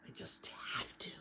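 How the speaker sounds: hushed, voiceless whisper of resolve; whispering a vow to himself. Acted. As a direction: breathe it out, barely voiced. Boy's desperate vow to himself.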